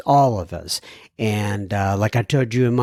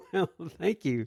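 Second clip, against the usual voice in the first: laughing; 5 semitones higher, 7 dB quieter than usual. Real, not acted. Listener email praises the podcast.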